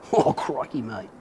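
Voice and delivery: put-on a light jokey Aussie "crikey, mate" in an Australian accent; mock Australian accent. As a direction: toss off a playful Australian accent. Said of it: "maybe like an Australian accent".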